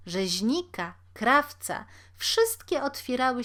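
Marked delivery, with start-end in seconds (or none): none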